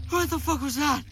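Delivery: ordinary talk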